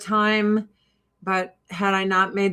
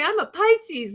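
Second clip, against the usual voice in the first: a touch higher, lightly voiced; mock claim; 9 semitones higher than usual. Claiming a fake sign to fool believers, lightly higher. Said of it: "you can say".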